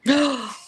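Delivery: real gasp of surprise; quick delighted gasp; excited gasp. Real, not acted. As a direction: gasp at a sudden sighting. Spots a baby deer outside.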